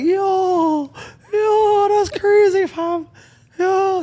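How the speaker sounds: very high, airy Toronto "yo" squeal; high ghostly Toronto mans voice; delighted ghost voice. Retold or imitated. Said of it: "sounding like delighted ghosts".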